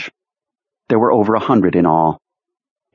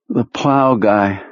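slow, weary tired voice; barely has the energy; 3 semitones higher, much slower than usual. Acted. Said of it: "in his tired voice".

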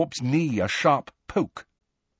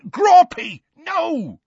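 very high, loud shout; alarmed cry; 6 dB louder, much slower than usual. A narrator's reading. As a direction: yell to stop the grab. Giant grabs at Hermione.

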